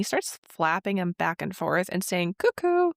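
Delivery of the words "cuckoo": high, loud birdlike coo-coo; mock cuckoo clock call. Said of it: "like a cuckoo clock".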